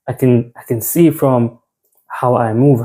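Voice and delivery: usual speaking voice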